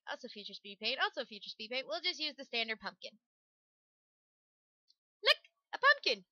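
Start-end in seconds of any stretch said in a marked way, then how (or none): 5.2-6.2 squeaky voice. Acted. Silly showing of pumpkin.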